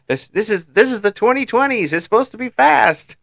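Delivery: very high-pitched comic indignation; mock outrage. Joking outrage at slow PC.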